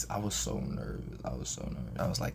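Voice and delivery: ordinary delivery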